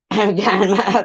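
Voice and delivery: dry-mouthed words collapsing into laughter; cotton mouth. Real, not acted. Streamer telling chat of dry mouth, laughing. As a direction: giggle through a dry mouth. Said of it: "I have cotton mouth".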